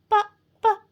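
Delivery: high, halting protest; nervous stammer. A narrator's reading. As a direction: stammer timidly as the little werewolf. Frightened pupil protests.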